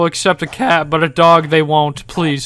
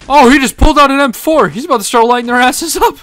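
excited; 9 semitones higher, 7 dB louder than usual. Real, not acted. Gary fights cops.

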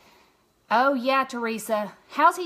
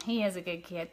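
softer echo; 4 semitones lower, 6 dB quieter than usual. Real, not acted. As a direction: say it again quietly, to yourself. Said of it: "Krista, he is a good kid".

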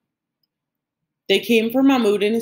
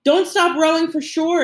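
high, urgent yell; yelled command; 10 semitones higher than usual. A narrator's reading. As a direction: call it out to the whole crew. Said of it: "grandfather yelled to everyone else".

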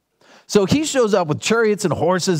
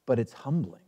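softer, lower "But it's humbling"; softer emphasis on humbling; 6 semitones lower, 7 dB quieter than usual. Acted. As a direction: drop to a quiet and humble tone. Preacher drops for emphasis.

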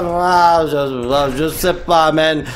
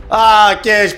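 ordinary talk, nothing marked

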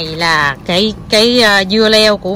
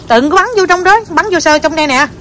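high, raised-voice warning; urgent warning; 7 semitones higher, 4 dB louder than usual. Real, not acted. Mom stopping child spraying water inside, high urgent warning. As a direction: call out quickly to stop the spraying.

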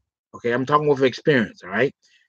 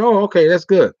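high woman's voice; 5 semitones higher, 4 dB louder than usual. Retold or imitated. Quoting the woman.

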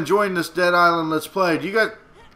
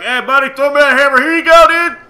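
put-on drunk dude voice; 9 semitones higher, 11 dB louder than usual. Voiced drunk guy in story.

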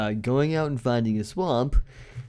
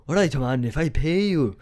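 a lightly put-on Scottish ogre accent; slightly louder, half-hearted Scottish brogue; hammed-up Shrek voice, quickly dropped; Shrek's Scottish accent; 3 semitones higher, 3 dB louder than usual. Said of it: "I have to do it in his accent".

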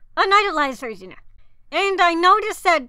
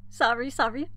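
normal talking voice

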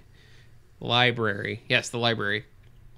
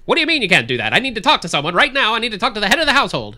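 loud, high, fast newsreel bark; old newsreel narrator; 8 semitones higher, 7 dB louder, faster than usual. Acted. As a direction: demand it like a newsreel announcer. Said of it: "I lost my newsreel voice".

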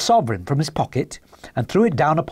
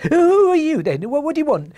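high, alarmed, quavering voice; startled little man's wavering squeal; 13 semitones higher than usual. Acted. Little man accosted by a stranger, quavering. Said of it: "asked in a quavering voice".

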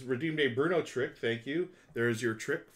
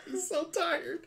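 laughing; 4 dB louder, slower than usual. Real, not acted. Laughing at yawning dog.

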